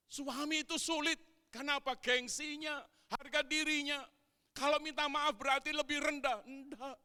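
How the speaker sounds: high, loud sermon delivery; preacher at full force; fiery preaching. Acted. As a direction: hammer the point to the congregation.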